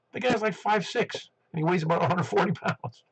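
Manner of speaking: retold surprise. Telling a radio friend about seeing the booming-voiced guy's picture, retold surprise. Retelling surprise at a small man's size.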